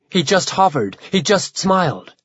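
plain reading voice

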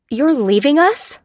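high, loud, drawn-out disbelieving question; alarmed cry. A narrator's reading. Captive realises she is being left behind, alarmed cry. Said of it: "cried Lucy".

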